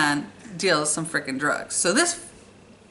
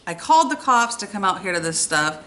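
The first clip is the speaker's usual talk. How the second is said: ordinary talk, nothing marked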